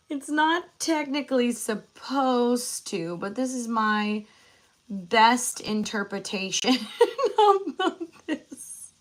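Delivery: laughing through the words. Real, not acted. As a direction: giggle while explaining. Laughs at own flag drawing.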